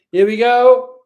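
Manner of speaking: loud, high cheer; excited cheer. Real, not acted. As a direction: cheer it out as the pour starts. Cheering as the pour starts.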